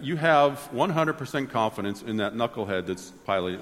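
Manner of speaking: normal talking voice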